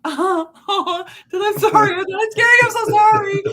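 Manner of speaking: sorry you jumped, so sorry; apologetic nice haunter. Pretend. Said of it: "I would probably be like".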